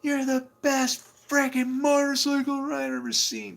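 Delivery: high, loud passing yell; imitating the yelling driver. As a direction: call it out from a moving car. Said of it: "he goes".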